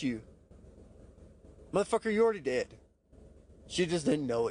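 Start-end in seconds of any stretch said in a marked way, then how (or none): 1.7-2.7 higher, fast cutting comeback; quick, higher scornful jab; scornful retort. Real, not acted. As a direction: snap back in disbelief. Retorting at his own excuse.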